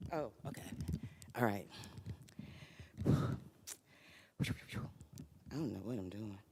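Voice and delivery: usual speaking voice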